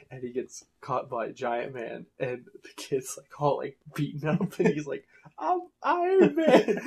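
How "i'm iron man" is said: put-on high squeaky kid voice through laughter, slowed; laughing squeal. Retelling a cartoon kid's cry, laughing high and squeaky. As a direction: squeak it out while cracking up. Beaten kid in retold skit.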